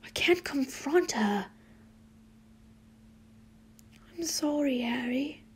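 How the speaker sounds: soft, near-whispered sorrow; subdued. Acted. Friends talk over a painful breakup, subdued. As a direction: say it softly, almost whispering. Sad confession and gentle sympathy.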